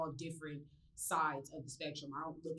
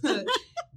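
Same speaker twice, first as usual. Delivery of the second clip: high, loud burst of laughter; real laugh; 12 semitones higher, 14 dB louder than usual. Real, not acted. Laughs about Suge Knight's car.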